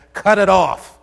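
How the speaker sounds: loud, high, cutting command; Jesus jolting his hearers with a loud command; emphatic command. Acted. Preacher quoting Jesus with a loud, high command. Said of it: "Jesus has no problem saying".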